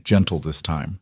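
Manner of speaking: plain reading voice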